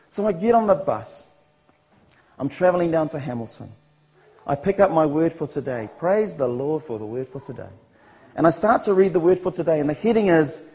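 ordinary talk

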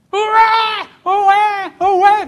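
high, loud imitated baby wail; screaming newborn baby. Preacher mimicking the newborn's first sudden cries, screaming. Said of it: "The screaming of this baby".

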